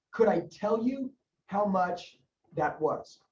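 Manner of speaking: very slow, spaced-out words; measured, with pauses for note-taking; slow dictation. Shown as a demonstration. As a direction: dictate it word group by word group.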